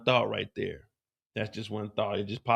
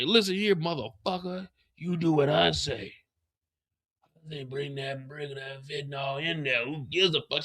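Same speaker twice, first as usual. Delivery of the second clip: Diamond's voice; 2 semitones higher, 5 dB quieter than usual. Retold or imitated. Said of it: "He's like".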